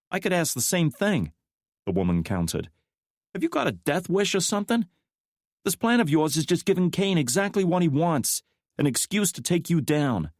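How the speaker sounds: a worried woman pushing back; McKnight's voice. A narrator's reading.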